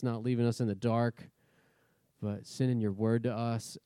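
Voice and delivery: humble prayer easing down to amen; spoken prayer. Real, not acted. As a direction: pray aloud, settling into a low, quiet murmur. Leads the congregation in prayer.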